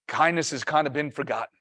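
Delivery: ordinary talk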